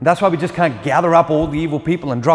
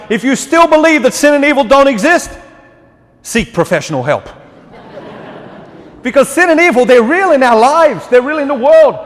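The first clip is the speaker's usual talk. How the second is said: higher, louder pulpit preaching, pressing the point; fervent preaching; 8 semitones higher, 4 dB louder than usual. Acted. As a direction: preach it out to the whole church. Raised preaching passage.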